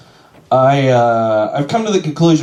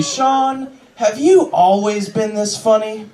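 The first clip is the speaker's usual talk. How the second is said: fan question; 8 semitones higher, slower than usual. Retold or imitated. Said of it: "they ask me".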